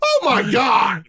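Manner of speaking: high, slow imitated cry; mock outrage. Imitating a shocked parent at a teacher conference, mock outrage. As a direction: exclaim it slowly, hammed-up. Mimics outraged person in clip.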